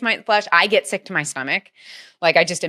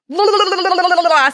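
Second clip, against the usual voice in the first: high, loud burst of laughter; emotional laugh; 11 semitones higher, 6 dB louder than usual. Real, not acted. Emotional laugh mid-story.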